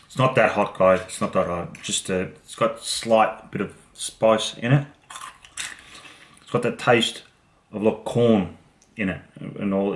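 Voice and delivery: chewing between words; talking while eating. Real, not acted. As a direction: mouth partly full. Seen 0: Keeps picking orange snacks and bringing one to mouth while talking.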